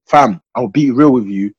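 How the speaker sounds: normal talking voice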